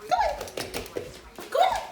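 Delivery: very high, encouraging coax. Real, not acted. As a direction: cheer the puppy on. Encouraging puppy up.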